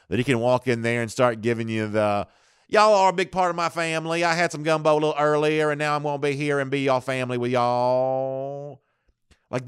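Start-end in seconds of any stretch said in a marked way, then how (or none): 2.7-8.8 lightly mocking Kelly's fake Louisiana folksiness; mock Southern accent. Host parodies Kelly pandering to LSU fans, mock Southern accent. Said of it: "this is how dumb he thinks L S U fans are".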